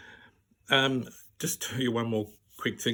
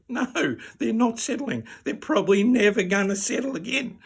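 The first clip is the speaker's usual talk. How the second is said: indignant; 9 semitones higher, 4 dB louder than usual. Retold or imitated. Patient answering whether things are settling, indignant. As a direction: draw out the no, then rise in protest.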